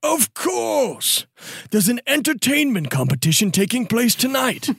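theatrical reveal with high swoops; Dwoot, officious informant. Acted. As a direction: build to the answer like a showy announcer.

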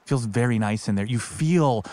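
normal talking voice

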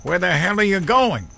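high, loud desperate yell; loud panicked shout. Acted. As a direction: call after him as he walks off. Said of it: "and Wally yelled".

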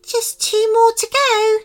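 very high little fairy voice; Lemming Fairy. Acted. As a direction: pitch it right up for a tiny fairy. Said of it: "said the Lemming Fairy".

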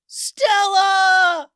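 high, loud joyful shout; yell. Acted. Ben Ben shouts back to Stella, overjoyed. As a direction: shout her name at full voice. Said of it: "yelled Ben Ben".

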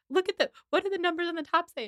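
high, hurried mock frustration; bickering map reader. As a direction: imitate a flustered passenger reading a map. Acting out map-reading bickering.

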